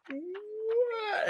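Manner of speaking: very high, startled squeak; mock surprise. Reader reacting to Grover, high mock surprise. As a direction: feign shock at the warning. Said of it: "Then you're like".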